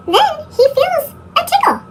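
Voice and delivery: high, playful ticklish voice. A narrator's reading. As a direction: sound tickled. Tickle in story.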